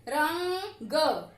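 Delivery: रंग stretched very slow to split र and अंग; drawn-out syllables. Shown as a demonstration. Teacher sounds out रंग slowly for students. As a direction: drag out रंग so the nasal अंग is heard. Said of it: "तो र के बाद आ रही है अंग की आवाज".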